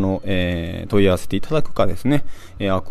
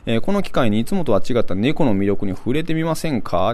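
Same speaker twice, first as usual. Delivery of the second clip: higher-pitched, swooping event pitch; promotional flyer read; 5 semitones higher than usual. Acted. Radio host reading cat film flyer to listeners, promotional read. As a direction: read the blurb like an upbeat promo.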